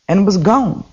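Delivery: ordinary delivery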